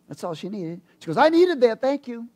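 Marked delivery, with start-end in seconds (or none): 1.2-2.3 loud, high burst of relief; imitated emphatic gratitude. Woman thanking him after scripture, loud relief. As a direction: punch it out high and grateful.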